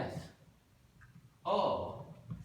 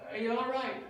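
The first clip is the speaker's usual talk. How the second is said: high, lightly voiced concern; a woman on the bridge asking kindly; concerned stranger; 10 semitones higher, 4 dB louder than usual. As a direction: ask it in a higher caring voice. Voice on the bridge quoted.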